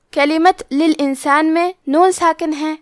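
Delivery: ordinary talk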